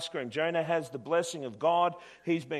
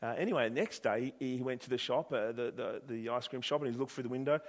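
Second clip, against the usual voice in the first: ordinary delivery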